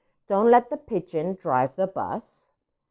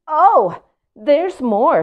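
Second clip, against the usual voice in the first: much louder, higher sassy pigeon; pigeon with attitude; 8 semitones higher, 8 dB louder, much slower than usual. Acted. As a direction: announce there's more with attitude. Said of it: "He's got an attitude".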